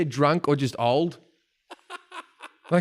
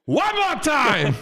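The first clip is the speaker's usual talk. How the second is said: high, slow, loud demand; hammed-up star stretching each word; Al Pacino; 11 semitones higher, 5 dB louder, much slower than usual. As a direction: egg them on, drawn out and high. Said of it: "And he went".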